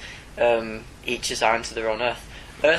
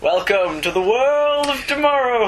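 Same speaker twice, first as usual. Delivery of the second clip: announcer voice; 12 semitones higher, 4 dB louder than usual. Retold or imitated. Joining the bit, announcing.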